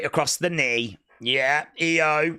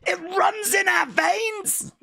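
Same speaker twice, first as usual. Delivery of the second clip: mock dramatic; 13 semitones higher than usual. Mock-dramatic wrestling devotion.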